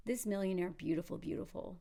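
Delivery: usual speaking voice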